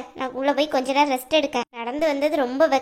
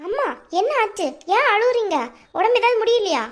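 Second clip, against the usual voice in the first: worried, higher-pitched questioning; high, concerned voice; alarmed concern; 6 semitones higher than usual. Acted. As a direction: ask anxiously what is wrong. Daughter finds mother crying.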